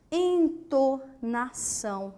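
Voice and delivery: entonação slowly as en, to, na, ção; entonação in evenly spaced syllables; syllable-by-syllable split. Shown as a demonstration. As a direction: measure out entonação one syllable at a time. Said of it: "Você vai separando por sílabas".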